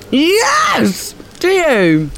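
high thrilled squeal; delighted. Real, not acted. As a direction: squeal with joy. Delighted he likes the dish.

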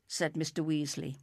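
ordinary delivery, nothing marked

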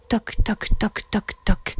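soft, evenly tapped knocking; imitated heartbeat. Narrator imitates the nervous little dragon's heart, softly knocking. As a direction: tap out a heartbeat under the voice.

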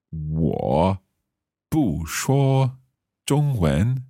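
slow 我不说中文 with 不 in the falling tone; tone demo. Shown as a demonstration. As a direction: say 不说 with a falling 不, syllable by syllable. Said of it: "So the bu changes".

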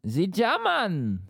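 exaggerated high German accent. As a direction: proclaim it in a hammed-up German accent. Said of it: "you've gotta give me a voice".

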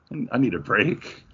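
ordinary talk

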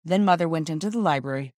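usual speaking voice

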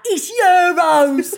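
slow, loud, laughing correction; crowd correcting. Retold or imitated. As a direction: call it out slowly with a laugh. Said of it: "all of them was like".

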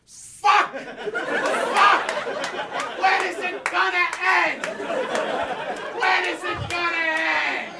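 high-pitched comic wail of despair; hammed-up street-corner anguish; anguished Brooklynites. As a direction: cry out to the heavens like a fed-up Brooklynite. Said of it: "everybody just walks around going".

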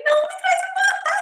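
very high, loud tearful wail; retold crying. Asking the flight attendant for water, very high and sobbing. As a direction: bawl the request. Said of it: "Aí eu comecei a chorar de novo".

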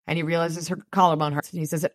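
ordinary delivery

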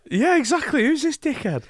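high-pitched mock outrage; indignant viewer. Singer plays viewers judging him on TV, mock-indignant. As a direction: imitate an affronted viewer, voice way up.

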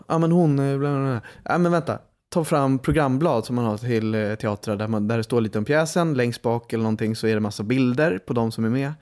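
ordinary delivery

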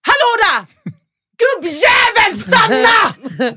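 furious high-pitched yell; Pontus Gårdinger. Retold or imitated. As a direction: yell after someone to stop, full force. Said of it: "tänder till och bara skriker".

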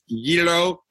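"gyro" pronounced the Greek-style way, YEE-ro. Shown as a demonstration. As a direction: say "gyro" as YEE-ro, with a y sound up front. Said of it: "I say, I've said gyro".

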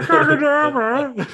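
loud, very high fat voice; Cheeks. Acted. Cheeks stuck on the top rope, loud high fat-voiced plea. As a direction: whine it loudly in a silly fat voice. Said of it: "a fat voice".

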